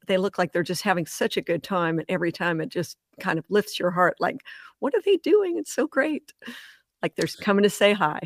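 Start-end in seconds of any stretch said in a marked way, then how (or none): 4.8-6.3 high-pitched, giddy marvelling; delighted wonder. Retold or imitated. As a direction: gush way up high, like watching dolphins play. Voices delight at watching the dolphins.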